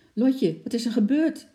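high, loud startled question; alarmed question. A narrator's reading. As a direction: ask it in sudden concern. Startled father questions rushing-in child.

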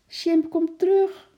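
high, loud, drawn-out scream; alarmed yell. A narrator's reading. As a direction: yell after your pet in fright. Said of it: "gilde Lotje geschrokken". (geschrokken gillend)

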